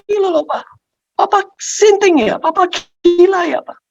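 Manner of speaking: very high incredulous protest; angry. Acted. Child asking papa if he has gone crazy, angry. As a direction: berate him in high-pitched outrage. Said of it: "Iya, aku marah".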